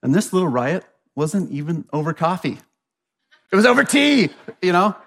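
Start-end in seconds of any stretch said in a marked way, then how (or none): 3.5-5.0 high, louder burst of disbelief; mock outrage. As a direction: hit the punchline in exaggerated incredulity. Mock outrage at tea riot.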